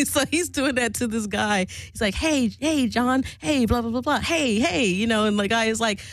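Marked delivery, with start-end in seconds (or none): 2.1-4.9 imitated eager pestering, pitched up; giddy husband. Husband pestering a muscular swinger at the table, giddy. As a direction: mimic an over-friendly husband nagging for attention. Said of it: "there he is, Mister Giddy".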